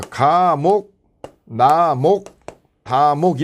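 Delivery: "가목", "나목", "다목" stretched, paused between; slow, drawn-out reading of item labels; formal item reading. Shown as a demonstration. Teacher demonstrates reading sub-item names, drawn-out citation. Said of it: "이렇게".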